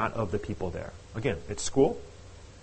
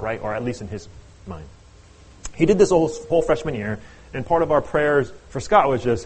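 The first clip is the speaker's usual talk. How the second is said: normal talking voice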